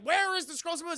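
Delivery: high, loud mock demand; demanding shout. Goliath to woken Xanatos, loud mock demand. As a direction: bellow the question at him.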